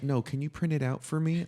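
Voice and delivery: normal talking voice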